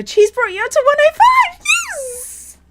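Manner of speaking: very high, loud triumphant shriek; real excited squeal. Real, not acted. Streamer shrieking at a new subscriber count, excited. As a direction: squeal it fast in sheer excitement. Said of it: "sorry, I'm excited".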